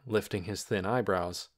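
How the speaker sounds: usual speaking voice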